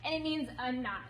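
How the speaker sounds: usual speaking voice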